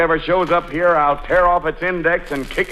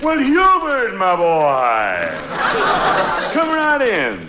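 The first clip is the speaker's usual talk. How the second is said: fawning over the favourite; sugary welcome to Hubert; overly warm welcome; 2 semitones higher, slower than usual. Acted. Father greets favoured suitor.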